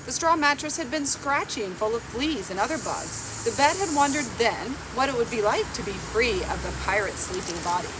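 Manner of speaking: usual speaking voice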